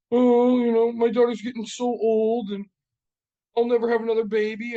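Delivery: high, loud wailing lament; hammed-up weepy whine; mock crying wife. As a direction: imitate a wife bawling, drawing it out. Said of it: "my wife's fucking crying".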